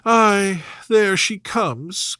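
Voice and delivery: high, plaintive, self-pitying complaint; whiny Mrs Bennet. A narrator's reading. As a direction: moan about her poor nerves.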